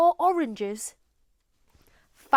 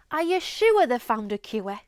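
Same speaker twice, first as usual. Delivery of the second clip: Geordie "sure" and "cure" with a centring vowel; Geordie accent; 3 semitones lower than usual. Shown as a demonstration. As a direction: model Geordie sentence. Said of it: "Listen to how Sophie says cure".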